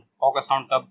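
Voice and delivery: ordinary delivery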